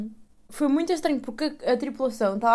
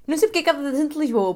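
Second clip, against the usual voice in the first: higher, louder and faster; emphatic; 7 semitones higher, 6 dB louder, faster than usual. Shown as a demonstration. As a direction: say it again, with force. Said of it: "repeats louder".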